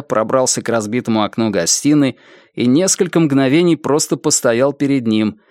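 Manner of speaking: plain reading voice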